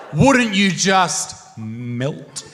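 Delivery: ordinary talk, nothing marked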